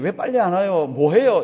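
loud mock complaint of an impatient baby. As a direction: demand it loudly, as a fussing baby. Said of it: "조금 더 큰 소리 울 때는".

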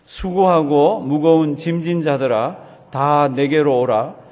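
loud, slow, measured congregational recitation; loud scripture reading. Real, not acted. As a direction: lead the room in reading aloud, slow and strong. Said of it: "큰 소리로 한번 봉독하십니다".

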